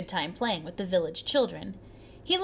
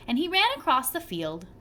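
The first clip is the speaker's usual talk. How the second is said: plain reading voice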